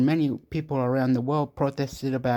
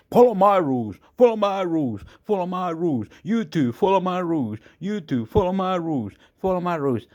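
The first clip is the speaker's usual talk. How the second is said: chanting orders like a bossy YouTube, raised and loud; mock YouTube voice; 6 semitones higher, 3 dB louder, faster than usual. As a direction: preach the rules like a stern platform. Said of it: "That's not what YouTube is saying".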